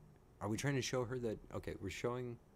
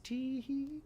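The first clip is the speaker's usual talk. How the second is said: high-pitched cutesy tee-hee; put-on squeaky little giggle; mock giggle. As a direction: titter in a tiny, mischievous voice. Giggling over who dies.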